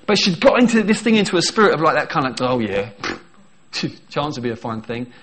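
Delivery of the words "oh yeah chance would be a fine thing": lower, dry mock scoff through a laugh. Preacher imitates Sarah scoffing at God's promise, lower mock scoff. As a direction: toss it off with a lightly mocked sneer. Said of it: "It's scoffing".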